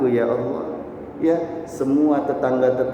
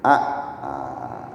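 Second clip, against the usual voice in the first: ordinary delivery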